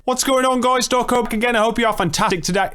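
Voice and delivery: very high-pitched YouTuber intro impression; Dawko. Streamer doing a requested YouTuber impression, very high mock intro voice. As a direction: squeal out a fast, chirpy channel greeting. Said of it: "do your best Dawko impression".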